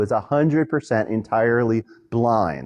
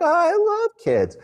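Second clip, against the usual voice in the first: mock the overeager call center agent gushing; gushing phone rep. Phone rep hears a dog barking, gushing high. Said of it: "They're like".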